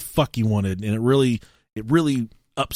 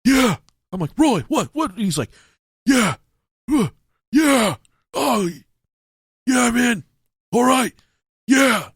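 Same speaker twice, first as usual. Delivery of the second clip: high, loud clipped yeahs; imitating distressed friend; 12 semitones higher, 4 dB louder than usual. Roommate on the phone sounding like he's in trouble, imitated. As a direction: play the friend who can't talk freely. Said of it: "screaming at me like he's in a fucking fight".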